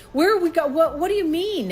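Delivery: firing questions in alarm; retold panic; panicked questioning.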